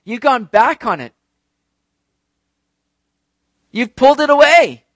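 loud, high-pitched charge against God; heated accusation. Acted. Acts out psalmist accusing God.

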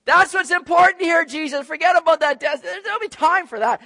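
high, loud, brushing Jesus off; impatient disciples. Retold or imitated. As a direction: interrupt him to talk about glory.

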